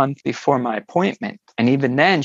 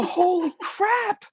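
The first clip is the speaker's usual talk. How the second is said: much higher, drawn-out, softer gasp of shock; mock-panicked, slow exclamation; retold panic; 4 dB quieter, slower than usual. Driver reading the friend's correct guess, panicked. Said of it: "I had such a panic attack".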